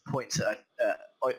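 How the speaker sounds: ordinary talk, nothing marked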